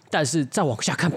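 ordinary delivery

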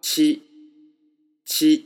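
チ held high and level, on its own; チ, a high, even, isolated syllable; syllable demo. Shown as a demonstration. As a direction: model チ loud and clear, twice. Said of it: "一番目の音節文字の発音は".

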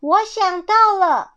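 high, loud eureka shout; excited storybook exclamation; sudden cry. A narrator's reading. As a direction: burst out with an idea. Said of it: "突然对刺猬征求叫".

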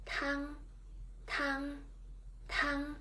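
"Tang" held high and level; first tone tang. Shown as a demonstration. Teacher modelling the first tone word "Tang" for learners. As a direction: keep "Tang" on one high steady pitch. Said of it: "Repeat after me for two times".

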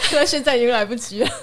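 laughing taunt, quick. Real, not acted. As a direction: giggle it to the absent counselor. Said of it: "辅导长，如果你有听到的话".

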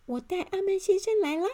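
high, loud elf voice; little elf. A narrator's reading. Elf answers the guard about his guest, high elf voice. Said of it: "小精灵立刻回答说".

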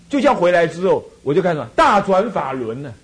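ordinary talk, nothing marked

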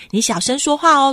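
plain reading voice

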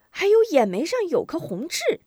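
put-on high-pitched child voice; excited boy. Boy remembers a mole for grandmother, high excited voice. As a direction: pipe it up as a sudden memory.

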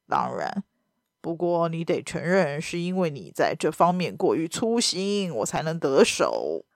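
a villain enjoying his win; smug baron. A narrator's reading. Villain baron voiced in story.

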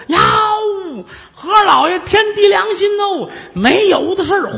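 hammed-up high wail begging the magistrate; wailing protest. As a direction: throw a tantrum of innocence. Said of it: "跟这儿撒泼打滚儿，眼泪哗哗的".

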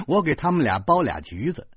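ordinary delivery

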